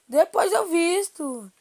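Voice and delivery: slow, loud drawn-out shout. Real, not acted. Shouts at someone off-mic. (grito alto e arrastado)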